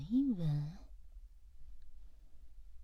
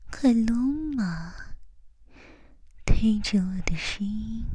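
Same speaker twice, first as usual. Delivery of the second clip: right in your ear. Acted. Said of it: "贴着你的耳朵说话吧". (贴耳低语)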